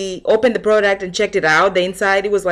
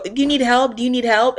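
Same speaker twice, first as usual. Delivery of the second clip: mock pushy clerk; 5 semitones higher than usual. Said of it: "Every time you go".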